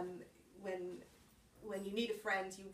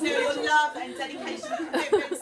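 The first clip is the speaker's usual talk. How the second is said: laughing; 7 semitones higher, 15 dB louder than usual. Real, not acted. Recovering from laughter.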